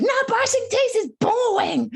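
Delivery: put-on high, slow whiny little girl; high, slow bored toddler voice; toddler whine. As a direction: drag it out like a bored three-year-old. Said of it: "And she said".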